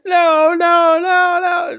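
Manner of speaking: high, loud screamed no; screaming. Retold or imitated. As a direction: shriek while hiding the face. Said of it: "covering his face and screaming".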